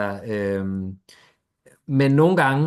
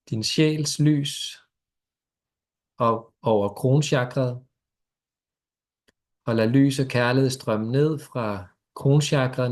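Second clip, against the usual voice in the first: guided meditation. Acted. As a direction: ease them gently into stillness.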